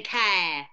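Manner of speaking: "care" with a stretched open vowel; London "cow" said as a long "care". Shown as a demonstration. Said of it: "Your vowels get really long".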